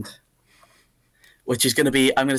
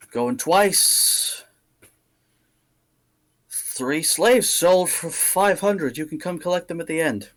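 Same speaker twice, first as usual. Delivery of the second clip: milk each bid like an auctioneer; auctioneer calling bids; 3 semitones higher, slower than usual. Acted. Auctioneer closes sale.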